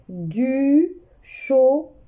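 "du chocolat" said slowly, syllable by syllable; teacher slowing down for pupils; slow repeat. Shown as a demonstration. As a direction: draw out "du chocolat". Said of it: "Je répète".

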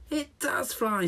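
high delighted squeal. Acted. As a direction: squeal it with joy. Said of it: "squealed the hedgehog".

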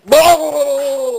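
loud, high monster yell; hammed-up horror movie creature; monster roar. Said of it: "a horror movie that comes out and goes".

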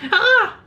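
very high acted scream; short falsetto shriek of terror.

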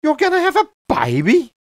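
high, loud amazed question; can't believe the happy news; squealing with delight; thrilled. Acted.

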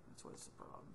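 whispered under the breath; muttered aside. Real, not acted. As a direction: mutter it to yourself while working.

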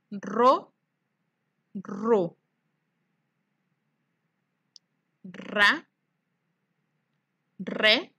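clear, spaced syllables with a pause after each; syllable drill. Shown as a demonstration. Teacher reading the syllable card aloud for the class. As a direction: read each syllable slowly, as a model to copy. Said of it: "vamos a leer las sílabas".

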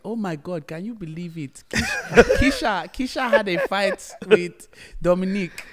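put-on gossiping mum, high, in a Nigerian accent. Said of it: "still has a strong, thick Nigerian accent".

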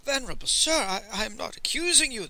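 high, stammering apology; apologetic merchant, hesitant; contrite. A narrator's reading. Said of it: "the merchant said contritely".

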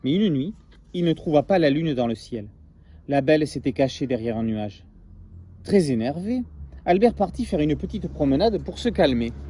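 lively storybook read-aloud; story narration. A narrator's reading. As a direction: tell it like a bedtime tale to children. Reads story to class.

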